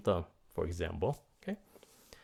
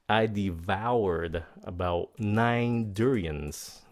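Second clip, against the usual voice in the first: slow, drawn-out example; 3 dB louder, much slower than usual. Shown as a demonstration. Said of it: "For example".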